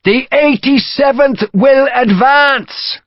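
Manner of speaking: high, slow, full-voiced announcement shout; shouted command. A narrator's reading. Commander ordering the eighty-seventh to advance, shouting. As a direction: shout it slowly to the whole regiment. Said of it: "Gough shouted".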